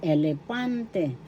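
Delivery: elepante slowly with the weight on pan; stress on third syllable. Shown as a demonstration. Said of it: "Third syllable is where the accent is".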